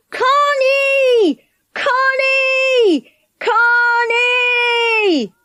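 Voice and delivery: very high, long-drawn hollering; shouting a name. A narrator's reading. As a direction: holler the name, stretching the last sound. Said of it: "They shouted".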